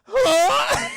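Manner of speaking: shouted, very high startled grunt; raptor huh. Retold or imitated. Raptor being hit by the flying girl, a shouted high yelp. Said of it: "I just love it".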